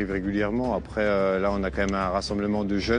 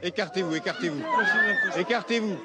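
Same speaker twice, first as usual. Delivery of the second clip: man down shout; 10 semitones higher than usual. Real, not acted. Gendarme reaches injured man in crowd.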